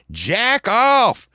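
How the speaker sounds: hammed-up stranger's shout; imitated creepy stranger. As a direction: call it out as the weirdo in the story. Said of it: "and he's like".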